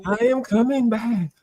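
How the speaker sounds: lightly spooky ghost voice; a hint of a returning ghost; ghostly voice. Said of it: "I gotta do the spooky noise".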